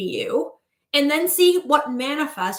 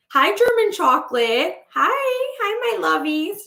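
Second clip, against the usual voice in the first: cooing pet greeting; 6 semitones higher, slower than usual. Real, not acted. Greets pets mid-stream.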